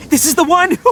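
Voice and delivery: "this is the one" shouted out high and thrilled; excited. Acted. Itto finding his beetle, thrilled high exclamation. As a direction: sell it like a jackpot.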